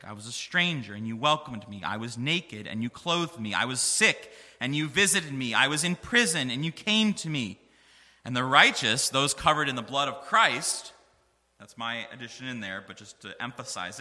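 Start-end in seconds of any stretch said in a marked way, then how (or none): 0.0-10.9 louder and louder, the verse shouted to the church; full-force preaching. Acted. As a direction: build it up like a preacher's climax.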